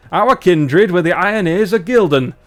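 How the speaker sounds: rough attempt at a northern English accent; butchered Barnsley dwarf. Dwarf explains who the kindred were, Barnsley accent. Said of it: "I'm really butchering northern British accents".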